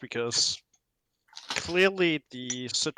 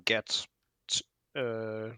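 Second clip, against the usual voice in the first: ordinary talk, nothing marked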